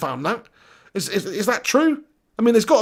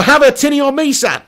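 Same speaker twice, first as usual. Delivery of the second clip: put-on loud, higher Australian accent with laughter; mock Australian accent; 5 semitones higher, 5 dB louder than usual. Streamer offering Aussie viewer a tinny, mock Australian accent. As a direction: ham up the Aussie, half laughing.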